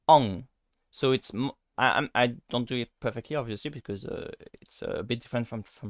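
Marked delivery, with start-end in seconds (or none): 0.1-0.5 ong said loud as a French nasal vowel. Shown as a demonstration. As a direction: nasalize ong, ending in the nose. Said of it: "but if I do like this".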